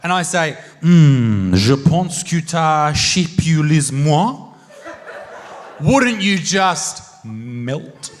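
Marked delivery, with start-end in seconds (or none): 0.8-4.5 slow, low French murmur at full volume; seductive French. Acted. Comic wooing an audience member in pretend romance, seductive French. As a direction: croon it slowly in mock-romantic French. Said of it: "do you find the French accent attractive".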